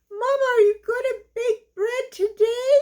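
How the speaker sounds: eager little chicks begging; excited baby chicks. Acted. Excited chicks ask mama.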